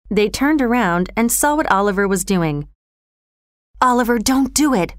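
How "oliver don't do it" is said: plain reading voice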